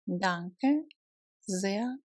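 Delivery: ordinary delivery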